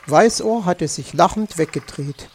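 ordinary delivery

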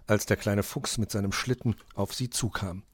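usual speaking voice

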